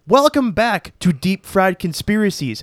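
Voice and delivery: booming ringmaster announcement. Acted. Grand show opening announcement.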